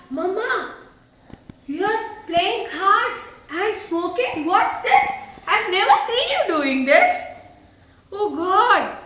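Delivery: acted shock at catching mother out; scandalised daughter, voice rising at the ends. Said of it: "She is shocked to see her mother".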